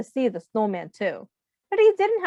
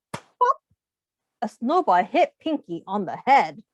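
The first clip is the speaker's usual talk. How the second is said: ordinary delivery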